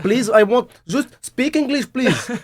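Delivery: high-pitched, pleading mock Indian English; Indian accent. Shown as a demonstration. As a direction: protest in an exaggerated Indian accent. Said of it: "avec un accent indien". (accent indien forcé, voix aiguë)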